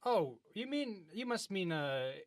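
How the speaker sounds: ordinary talk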